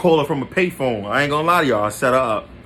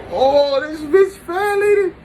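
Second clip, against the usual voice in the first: very high, drawn-out wail; anguished outcry; 4 dB louder, slower than usual. Retold or imitated. Storyteller reliving reading his girl's cheating messages, anguished high wail. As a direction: heartbroken falsetto outburst, loud and stretched.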